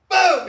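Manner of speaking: a sudden loud shouted boom, high and sharp; gunshot boom. Retold or imitated. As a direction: blast it out like a gunshot. Gunshot in retold story.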